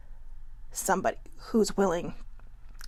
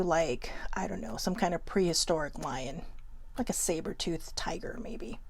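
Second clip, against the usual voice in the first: soft hushed whisper, fading to nothing; 3 semitones lower, 8 dB quieter than usual. Real, not acted. Confiding to viewers how hangry she gets, whispered. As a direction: let the words sink into a whisper. Describes turning into a hangry beast.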